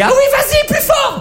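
put-on very high loud girly cry; mock girlfriend. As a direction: shout it like an excited lover. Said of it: "ma copine me dit".

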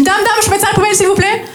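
high Marseille schoolkid voice; imitating a pupil butting in; interrupting pupil. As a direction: pester the teacher, pitch held up high. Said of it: "j'ai un élève qui m'interrompt".

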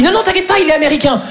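high-pitched breezy dismissal; mock reassurance. Chinese friend reassuring him about the director, high-pitched mock reassurance. Friend brushing off his worry.

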